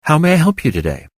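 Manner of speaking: a cheerful machine greeting the caller; chipper AI switchboard. A narrator's reading. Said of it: "The voice held all the chipperness".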